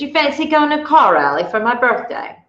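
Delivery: a friend's breezy British invitation; British accent. Retold or imitated. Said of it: "cue my British accent".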